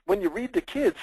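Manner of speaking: ordinary talk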